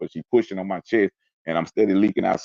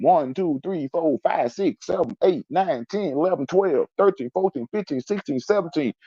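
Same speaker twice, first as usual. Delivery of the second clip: high-pitched, rhythmic running count; insistent count in a raised voice; urgent counting aloud; 11 semitones higher than usual. Retold or imitated. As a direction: imitate a medic tallying wounds. Acts out medics counting wounds.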